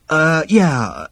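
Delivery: sheepish, hesitant reply; muttered. A narrator's reading. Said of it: "he muttered".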